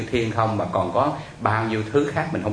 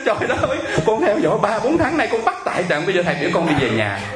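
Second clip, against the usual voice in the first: high, protesting cry that gradually comes down, imitated; aggrieved wail; 9 semitones higher than usual. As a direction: act out a caller's outraged disbelief. Caller wails at being sent home. (giả giọng kêu trời phản đối)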